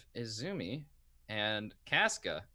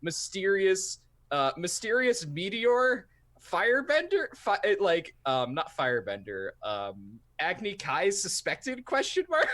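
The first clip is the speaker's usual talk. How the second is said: dramatic headline read; 6 semitones higher, 4 dB louder than usual. Acted. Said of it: "the title article under it is, like".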